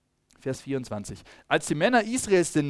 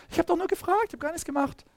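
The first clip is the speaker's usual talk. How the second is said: innocent protest; 14 semitones higher, faster than usual. Shown as a demonstration. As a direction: show how innocently he said it. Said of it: "Also, seht ihr so, so dieses". (hohe, unschuldig-empörte Jungenstimme)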